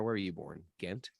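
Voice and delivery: normal talking voice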